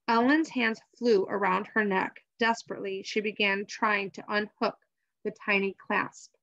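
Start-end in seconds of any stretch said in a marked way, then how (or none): none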